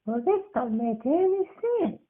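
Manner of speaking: put-on high childlike voice, baffled; a kid scratching his head; high boyish puzzlement; puzzled little boy.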